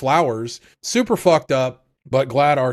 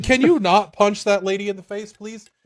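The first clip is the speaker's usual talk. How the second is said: mock pleading; 7 semitones higher, 3 dB louder than usual. Engine pleading with Rudo.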